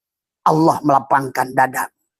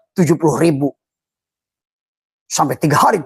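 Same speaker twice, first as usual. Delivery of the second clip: usual speaking voice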